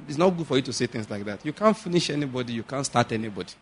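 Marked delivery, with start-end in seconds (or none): none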